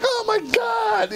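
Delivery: very high, stretched-out retold cry; mock alarm. Jumper losing his air near landing, high mock alarm. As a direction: squeal it slowly in playful panic.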